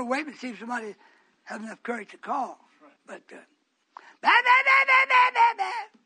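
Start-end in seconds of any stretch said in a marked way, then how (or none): 4.2-6.0 high, loud, monotone bleating babble; mocking babble. Preacher mimics the babblers, loud mocking babble. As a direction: rattle off nonsense syllables on one high note.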